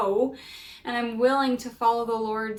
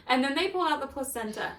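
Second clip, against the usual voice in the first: ordinary talk, nothing marked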